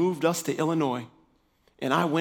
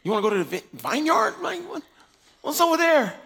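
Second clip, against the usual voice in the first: high, doubtful what-really tone; skeptical; 10 semitones higher than usual. Retold or imitated. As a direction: sound unconvinced and a little mocking. Skeptical reply to wife.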